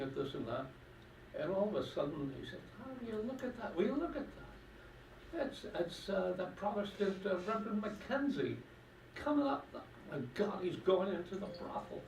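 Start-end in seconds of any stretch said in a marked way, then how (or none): 2.8-12.0 put-on high, scandalised old Irish pensioner; old fella gossiping. Pensioner in the pub spotting the reverend, scandalised and high. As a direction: gasp it out like a nosy old man.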